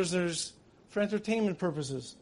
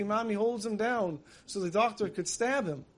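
ordinary talk

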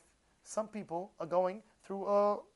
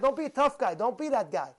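normal talking voice

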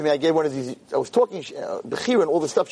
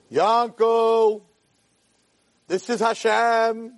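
high, drawn-out call from heaven; voice of Hashem; 6 semitones higher, much slower than usual. Acted. Hashem calls to Yankel the farmer, drawn-out heavenly voice. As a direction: stretch each word like a booming summons. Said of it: "he hears a voice".

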